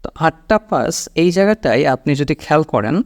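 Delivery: normal talking voice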